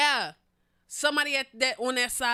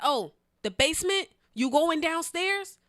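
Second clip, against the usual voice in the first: raised, drawn-out questioning; playing hard of hearing; 7 semitones higher, 5 dB louder, slower than usual. Mishearing the man on purpose in retelling, loud questioning. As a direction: ask it high and loud, as over noise. Pretends to mishear him over noise.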